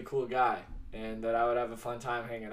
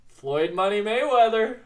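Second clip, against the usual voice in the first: louder and higher, proud announcement; excited reveal; 9 semitones higher, 9 dB louder than usual. Real, not acted. Revealing the boxer to friends, excitedly announcing. Triumphant reveal of trivia answer.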